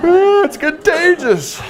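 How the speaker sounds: high, slow words through laughter; real amused laughter, slow and high; laughing. Real, not acted. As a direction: laugh it out while fighting a yawn. Yawning and laughing at audience.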